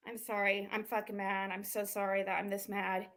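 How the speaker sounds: deflated, apologetic hush; subdued apology. Real, not acted. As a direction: drop down and say sorry to chat. Apologizing to chat after the outburst.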